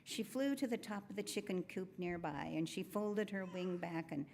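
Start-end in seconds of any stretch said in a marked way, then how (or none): none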